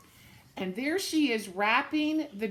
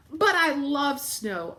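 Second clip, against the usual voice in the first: put-on slow, higher, louder eager girl; insisting little girl; 5 semitones higher, 6 dB louder, slower than usual. Irene insists to her sick mother, slow eager plea.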